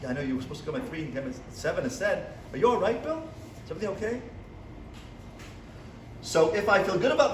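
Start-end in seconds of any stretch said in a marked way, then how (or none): 0.1-4.2 soft, tender concern for Bill; quiet, kind and reassuring; gentle and caring. Pretend. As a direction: speak softly as if comforting a late client. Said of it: "if I had love in me".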